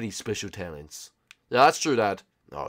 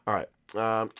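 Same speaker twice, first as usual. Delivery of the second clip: ordinary talk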